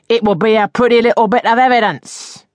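high, loud, gloating threat; rising voice. A narrator's reading. Landlady keeps the letter as evidence, voice rising high. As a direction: raise the voice in menace. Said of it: "Here her voice rose".

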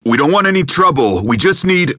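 high, loud, anxious pleading; urgent plea. Acted. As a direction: talk him down fast, scared of the gun. Pleading with armed man.

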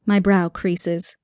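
ordinary delivery

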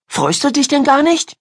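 put-on high girl's voice, let down; disappointed. A narrator's reading. Miriam asking whether Ida is glad, disappointed. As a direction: ask it high, a touch hurt. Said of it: "fragte Miriam enttäuscht".

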